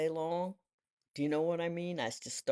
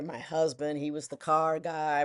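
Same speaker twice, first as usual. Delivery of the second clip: ordinary talk, nothing marked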